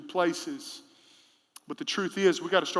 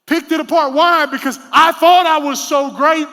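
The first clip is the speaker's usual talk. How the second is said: high, loud emphatic declaration; loud preaching; 8 semitones higher, 12 dB louder than usual. Real, not acted. Preacher to congregation, loud emphatic shout. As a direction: shout the point home.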